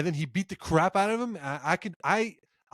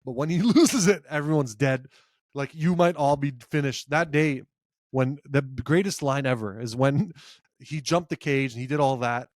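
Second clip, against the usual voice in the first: ordinary talk, nothing marked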